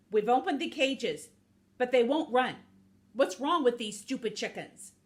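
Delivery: acted loud frustration; exasperated. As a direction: gripe at full projection, fed up. Snake exasperated by the chickens.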